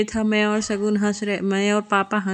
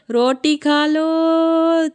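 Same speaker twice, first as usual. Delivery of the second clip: high, very slow, drawn-out call of "रोटी खा लो", louder; child's loud call; 9 semitones higher, 4 dB louder, much slower than usual. Retold or imitated. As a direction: call out "रोटी खा लो" across the colony like a little girl. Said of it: "colony में हल्ला कर रही थी". (बच्ची की तरह ऊँची, बहुत खींची हुई पुकार "रोटी खा लो")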